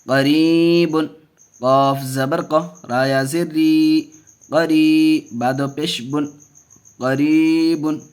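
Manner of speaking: slow chant with long-held vowels; measured teaching recitation; Qaida spelling recitation. Shown as a demonstration. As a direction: stretch the long syllable, keep short ones brief.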